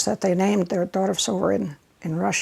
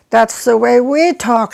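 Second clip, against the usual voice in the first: louder delighted exclaim; excited quote; 4 semitones higher, 9 dB louder than usual. Retold or imitated. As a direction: burst out with recognition. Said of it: "she said".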